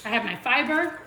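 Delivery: talking through a mouthful of food; words round a bite of bun; mouth full. Real, not acted. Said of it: "Sorry, I'm talking with my mouth full".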